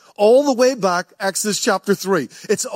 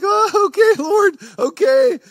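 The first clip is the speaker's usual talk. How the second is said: high, loud, slow startled "okay"; a stunned disciple catching his breath; 13 semitones higher, 4 dB louder, slower than usual. Retold or imitated. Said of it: "You're like".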